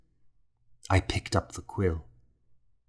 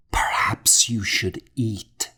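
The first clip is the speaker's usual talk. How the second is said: whispered; 2 semitones higher than usual. A narrator's reading. Narrator urges the weak old monk to eat, whispering. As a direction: breathe it gently to the old man. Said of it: "I whispered".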